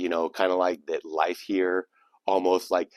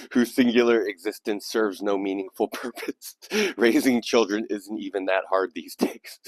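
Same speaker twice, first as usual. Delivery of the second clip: laughter breaking into the recital; real laughing; 3 dB louder than usual. Real, not acted. Host cracking up at a listener's poem, laughing as he reads. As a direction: push on through the giggles.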